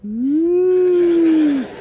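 high, long-drawn "ooh"; mocking ooh. Heckling himself over a London meeting, mocking high ooh. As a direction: swoop up as if mock-impressed.

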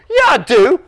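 put-on high, loud childish comeback; defiant little brother. A narrator's reading. Dave contradicting his brother Brian, defiant. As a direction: insist like a squabbling kid.

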